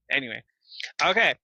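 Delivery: normal talking voice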